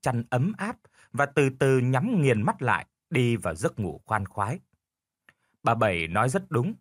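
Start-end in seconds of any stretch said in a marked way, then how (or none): none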